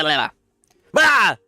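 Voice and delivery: usual speaking voice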